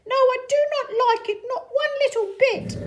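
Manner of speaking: high, fast, loud fish complaint; fish character voice. Acted. Fish fallen in the pot complains, high and fast. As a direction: whine it out quickly. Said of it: "said our fish as he lit".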